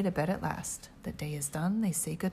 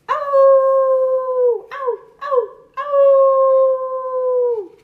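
very high loud howl; dog howling; 13 dB louder, much slower than usual. Acted. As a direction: howl at the moon. Dogs howling at moon.

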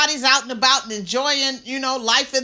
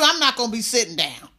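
ordinary talk, nothing marked

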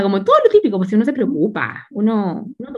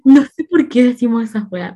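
ordinary talk

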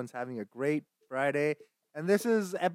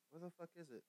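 normal talking voice

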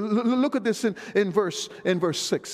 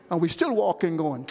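ordinary delivery

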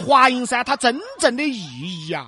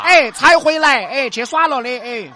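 put-on higher, louder chatty old auntie; old granny voice; 5 semitones higher, 8 dB louder than usual. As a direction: play a loud Guang'an granny. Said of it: "两个广安老妹儿们儿".

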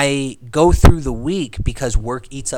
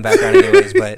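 laughing; 6 dB louder than usual. Real, not acted. Laughing while video plays.

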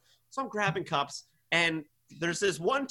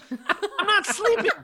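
exasperated; 12 semitones higher, 3 dB louder, slower than usual. Real, not acted.

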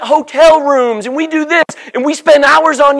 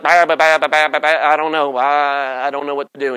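lower, softer mock stammer; a lightly voiced, lower stuttering excuse; 8 semitones lower, 4 dB quieter than usual. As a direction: sputter helplessly like Moses before Pharaoh. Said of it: "you're gonna be stammering and stuttering".

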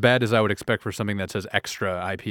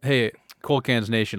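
ordinary delivery

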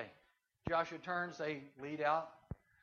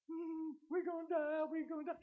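stage-fright panic; 11 semitones higher, 3 dB quieter than usual. Acted. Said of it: "Some of 'em are in total control. Others are going".

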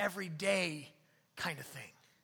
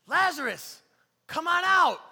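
high, ringing shout; big, high, drawn call; yelled summons; 9 semitones higher, 11 dB louder than usual. Acted. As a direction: shout it out to someone inside a tomb. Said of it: "he yells, and he's like".

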